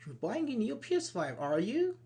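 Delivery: high-pitched surprised echo; demonstrated surprise, lifting on "are you?". Shown as a demonstration. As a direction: echo it back in surprise, tag rising. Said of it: "It's like, surprise".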